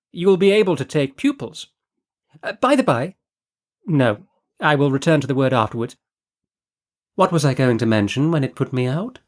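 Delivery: breezy, scatterbrained noblewoman chatter; Lady Fareway. Acted. Narrator voices Lady Fareway.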